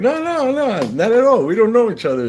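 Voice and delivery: very high, loud protesting imitation; hammed-up high-pitched insistence; mock frantic denial. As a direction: deny it all, voice shooting up. Pranksters' panicked denial acted out.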